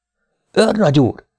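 high, alarmed call to the major; urgent call. Acted. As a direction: call him back in fear. Sergeant calls major again. (magas, riadt megszólítás)

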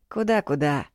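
plain reading voice

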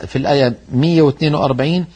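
normal talking voice

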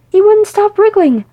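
loud, high-pitched protest; flustered self-justifying outburst; indignant defence. A narrator's reading. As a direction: protest your innocence loudly. Defending himself against accusation.

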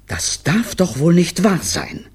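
high, slow, stunned exclamation; appalled disbelief. Acted. Mother appalled at her children, slow high disbelief. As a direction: draw it out in horror.

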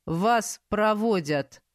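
slow, gloomy guard captain. A narrator's reading. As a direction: say it darkly and slowly. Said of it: "мрачно выдал он".

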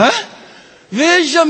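ordinary talk, nothing marked

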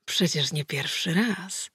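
soft slow teasing line; quiet amused retort; charming smile. A narrator's reading. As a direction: say it with a sly grin. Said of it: "z szermowskim uśmiechem".

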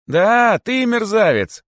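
high, loud, joyful taunt; gleeful shout. A narrator's reading. Cheeky rabbit confirms the insult, shouting with glee. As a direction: shout it gleefully at the top of your range. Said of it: "радостно закричал дерзкий кролик".

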